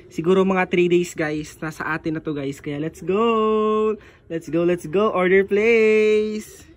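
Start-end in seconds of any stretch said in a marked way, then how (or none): 2.6-6.7 slow, louder "let's go"; hyped. Real, not acted. Vlogger cheering while placing the order. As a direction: cheer as the order goes through.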